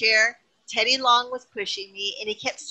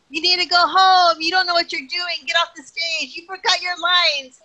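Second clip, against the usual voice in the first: higher, louder jeering crowd; mock crowd heckling; 8 semitones higher, 6 dB louder than usual. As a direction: heckle like a rowdy arena crowd. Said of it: "they started saying things like".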